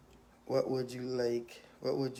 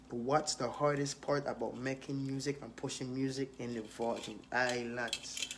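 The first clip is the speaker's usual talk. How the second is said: usual speaking voice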